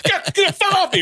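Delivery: high, frantic imitated yelp; hammed-up high-pitched panic; mock panic. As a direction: yelp it as if swatting bees. Child flailing at swarming bees.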